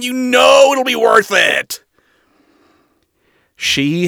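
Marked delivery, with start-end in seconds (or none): 0.0-1.8 high, loud gleeful shout; excited shout. Acted. Adam coaxing Lute.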